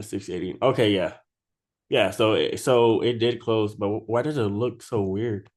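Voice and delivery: ordinary talk, nothing marked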